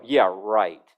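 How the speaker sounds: low, dry, dismissive "Yeah, right". Shown as a demonstration. Preacher showing tone to the congregation, low dismissive. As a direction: drop it low and flat like you don't buy it. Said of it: "Just by my tone of voice".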